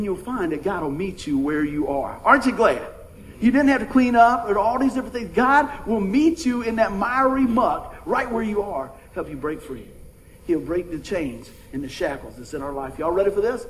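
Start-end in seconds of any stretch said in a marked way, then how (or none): none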